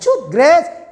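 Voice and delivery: father calling his daughter from the garden. Retold or imitated.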